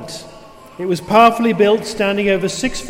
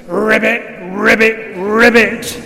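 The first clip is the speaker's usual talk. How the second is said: louder mock frog croaking; gigantic croaks; 4 dB louder, slower than usual. A narrator's reading. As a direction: croak it out big. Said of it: "The gigantic croaks rattled the pictures".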